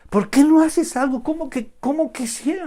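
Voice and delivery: high-pitched, baffled complaint; imitating an impatient believer; acted protest. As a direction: start loud, keep the pitch up in disbelief. Voices the protest once more.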